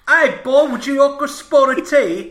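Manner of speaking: loud, high, hammed-up fake Cockney; bad Cockney accent. Parent-to-be offering tea, bad Cockney accent. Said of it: "like, really bad Cockney accents".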